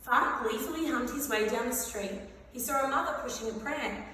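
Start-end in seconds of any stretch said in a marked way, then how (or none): none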